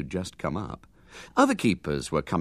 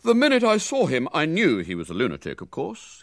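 talking through a bun; mouth full; 7 semitones higher than usual. Acted. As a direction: speak round a mouthful. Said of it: "speaking through a mouthful of currant bun".

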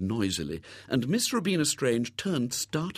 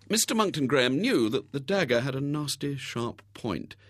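ordinary delivery, nothing marked

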